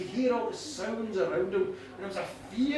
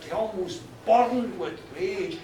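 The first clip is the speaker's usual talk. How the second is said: ordinary delivery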